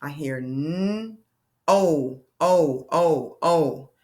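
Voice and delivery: teacher sounding out long O. Shown as a demonstration. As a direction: punch each o. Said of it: "listen to the sounds".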